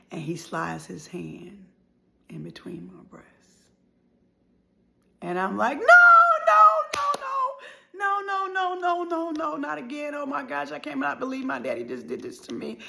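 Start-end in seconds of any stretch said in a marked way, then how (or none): 0.1-3.3 whispered, dropping to a breath; a whisper on the worst part; hushed telling. Retold or imitated. Telling of the abuse moment. 5.8-12.8 high frantic no, no, no fading to disbelief; panicked refusal. Retold or imitated. Reliving panicked refusal to father.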